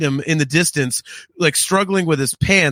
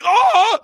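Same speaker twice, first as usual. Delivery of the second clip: pained yelp; 14 semitones higher, 3 dB louder than usual. Retold or imitated. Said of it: "And he's like".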